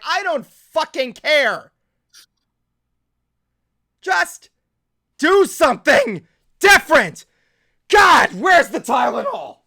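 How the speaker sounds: slow, loud, fed-up yell; ranting at full volume, words spaced out; exasperated shouting. Real, not acted. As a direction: shout each word separately, at wit's end. Frustrated rant reaching breaking point.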